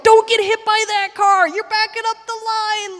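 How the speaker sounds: high, loud frantic yell; volunteer yelling at a car in the chaos; yelling warning. Retold or imitated. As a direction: shout a warning over traffic.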